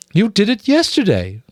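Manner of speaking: high, eager realization; she's just put it together; excited cry. Acted. Mother mouse to the rats, high and eager. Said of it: "cried Missus Frisby".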